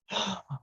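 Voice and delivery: high startled gasp of sudden craving; a quick high intake of breath in surprise; a god caught off guard, gasping; sharp gasp. Retold or imitated. Shiva hears of Vishnu's prasad.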